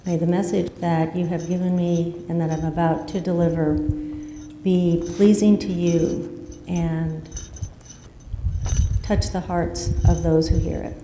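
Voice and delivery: hushed, reverent prayer. Real, not acted. Pastor leads opening prayer.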